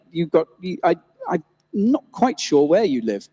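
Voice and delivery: ordinary talk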